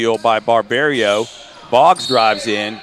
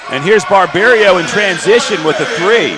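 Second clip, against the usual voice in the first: higher, louder live call; excited play-by-play; 7 semitones higher, 6 dB louder than usual. Real, not acted. Announcer calling Barberio's three in transition, excited. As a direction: lift the voice as the shot goes up.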